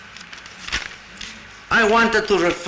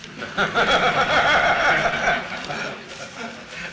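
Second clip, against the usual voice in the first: laughing; 5 semitones higher than usual. Real, not acted. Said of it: "my Russian accent".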